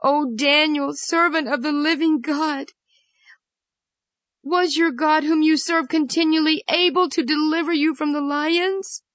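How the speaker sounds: high, anguished call of a king; sad cry. A narrator's reading. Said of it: "he cried out sadly".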